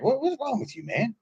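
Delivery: high-pitched exasperated challenge; mock scolding. Speaker answering men in tears, mock scolding. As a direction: confront a blubbering man in disbelief.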